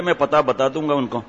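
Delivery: normal talking voice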